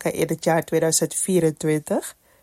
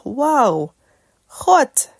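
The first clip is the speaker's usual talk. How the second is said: amazed; 6 semitones higher than usual. Retold or imitated. Amazed reaction to own recording.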